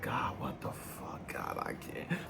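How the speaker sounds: hushed, whispery retold despair; crying out to God. Single dad alone in his room praying to God, despairing. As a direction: drag it out softly, nearly voiceless. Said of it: "I'm just crying out to God".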